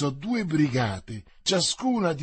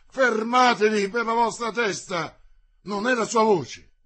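high, loud cry to halt; urgent shouted order; 7 semitones higher, 5 dB louder than usual. A narrator's reading. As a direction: shout to hold the men back. Same leader keeps shouting to halt. (ordine gridato, alto e concitato)

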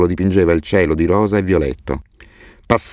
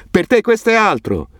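grido molto acuto attraverso la strada; shout from afar. A narrator's reading. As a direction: mani a coppa, chiama da lontano. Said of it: "con le mani attorno alla bocca mi gridò". (Hassan grida dalla fine della strada: richiamo acuto)

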